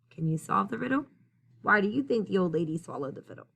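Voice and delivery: ordinary delivery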